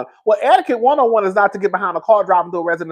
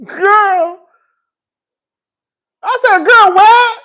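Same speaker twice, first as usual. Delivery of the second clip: dramatic outburst; 13 semitones higher, 7 dB louder, much slower than usual. Real, not acted. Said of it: "sometimes I'm dramatic".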